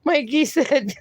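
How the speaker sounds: normal talking voice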